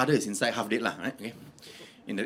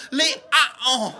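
high, wailing mock shock; hammed-up soap opera outburst; overacting; 5 semitones higher, slower than usual. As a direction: turn on the bad news with melodramatic grief. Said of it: "everybody else turn".

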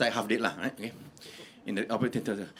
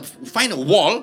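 ordinary talk

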